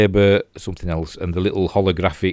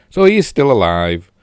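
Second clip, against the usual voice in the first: talking through a burp; words with a burp; 2 semitones higher, 6 dB louder than usual. Real, not acted. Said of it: "so I'm burping".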